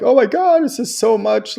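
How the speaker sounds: mock-overwhelmed, high and loud; overwhelmed exclamation. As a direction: imitate someone freaking out in a high voice. Mimics overwhelmed people's reaction.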